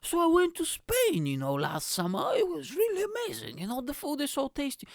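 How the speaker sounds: mocking a man boasting about Spain, put-on high voice; mock bore. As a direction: parody the guy droning on about his holiday. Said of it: "He's gonna tell his stupid stories".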